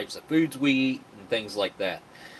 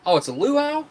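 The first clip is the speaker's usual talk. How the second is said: much higher, louder surprised "oh"; sudden realization; 7 dB louder than usual. Real, not acted. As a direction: it just dawned on you, pitch jumps up. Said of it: "Am I pronouncing that correct?".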